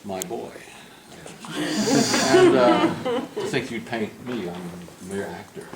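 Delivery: a hint of a plummy stage actor oozing charm; charming old actor. Painter imitating the actor flattering him, lightly theatrical. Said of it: "this is the way he talked".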